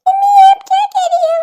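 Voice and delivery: put-on high, loud shocked little girl; a small girl crying out in disbelief; shocked child. Daughter stunned as mother sides with the uncle, shocked cry.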